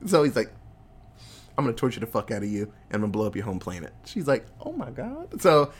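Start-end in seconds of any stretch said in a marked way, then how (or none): 1.6-3.9 fast, low, quiet threat; mock menacing threat. Vader threatening Leia, low hurried mock menace. 4.6-5.4 tiny, high, soft gasp of dismay; mock shock. Leia reacting to Vader's threat, high quiet mock shock.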